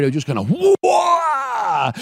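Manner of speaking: high, sudden mock roar of the brakes; imitated brake screech. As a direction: burst out with the plane's screech. Brakes slam, mimicking plane noise.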